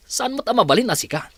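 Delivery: sharp demand. Acted. Boss presses the housekeeper.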